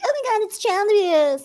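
high, exaggerated pearl-clutching voice; mocking outraged commenters. Mocking online commenters for a friend, high and shocked. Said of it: "they're like".

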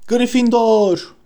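high, drawn-out proclamation; slow, ringing high call; shouted announcement. A narrator's reading. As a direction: stretch the house name into a high cry. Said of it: "diye bağırdı şapka".

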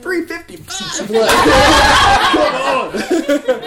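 high, drawn-out incredulous cry; retold astonishment breaking into laughter; mock disbelief. Teller joking to God about the late timing, mock disbelief.

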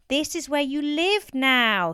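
slow, high, loud shout. A narrator's reading. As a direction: shout slowly so a deaf father hears. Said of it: "Stephen shouted".

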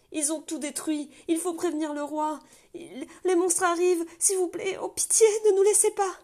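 high, loud, panicked cries through a door; desperate pleading shout. Acted. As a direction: beg to be let in, terrified. Gardien implore, sanglotant. (gardien affolé qui supplie qu'on ouvre la porte, hurlant de terreur)

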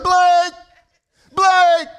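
high, loud search call; yelling name. Retold or imitated. Security officer searching for missing boy, loud call. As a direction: call out across the grounds. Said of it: "she's yelling".

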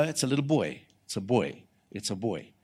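soft, dropping low; quiet assurance. Retold or imitated. Preacher telling expectant couple the sex, quiet and certain. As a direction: say it softly as settled fact.

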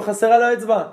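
high, loud alarmed shout; sudden yelling. Retold or imitated. Witch doctor discovering a finger is missing, yelling. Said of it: "פתאום מתחיל לצעוק".

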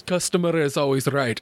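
imitated thick Russian accent; deadpan retort in a Russian accent; heavy Russian accent. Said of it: "this really heavy Russian accent".

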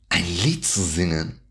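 normal talking voice

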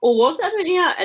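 high, quick surprised question; sudden surprise. Real, not acted. Surprised reaction.

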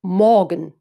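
Morgen with the weight on it; greeting with stress on Morgen. Shown as a demonstration. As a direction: lean on the word Morgen. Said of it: "Betonung dann meist auf dem".

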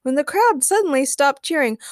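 ordinary delivery

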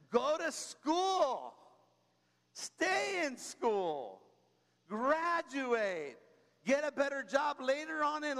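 slow, punched commands; emphatic exhortation. Real, not acted. Preacher urging young listeners, slow emphatic command. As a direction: drive each word home slowly.

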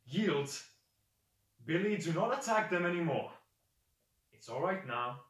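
a loud, lightly acted master's command; commanding beast-handler. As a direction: order the beast to stand down. Figure calls off his beast Billy.

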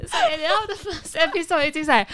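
usual speaking voice

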